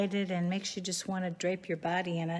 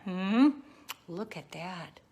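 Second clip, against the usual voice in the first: normal talking voice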